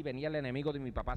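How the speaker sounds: usual speaking voice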